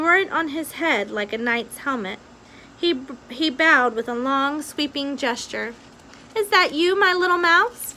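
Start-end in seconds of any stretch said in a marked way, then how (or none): 6.3-7.9 princess asking. A narrator's reading. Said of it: "P asked".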